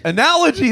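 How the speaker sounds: Analogy. said high, ah-NAL-ogy, falling; imitating the correct say; mock-correct pronunciation. Shown as a demonstration. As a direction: say Analogy. the right way. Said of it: "there it is".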